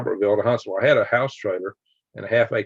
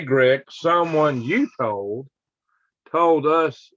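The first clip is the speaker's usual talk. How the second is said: ordinary delivery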